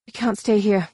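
lightly voiced urgent heroine; urging. A narrator's reading. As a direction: press him to get moving. Heroine urges warrior to flee.

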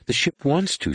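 high, lightly acted wail; understated character wail. A narrator's reading. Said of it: "she wailed".

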